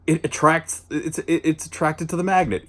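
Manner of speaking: a high, earnest show-and-tell voice, lightly voiced; mock-patient teacher explaining a toy; explaining a magnet. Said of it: "explains the concept of a magnet".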